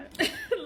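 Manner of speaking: a burst of high laughter. Real, not acted. Daughter laughing at her mother's reaction, real laugh. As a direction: crack up at your own impression.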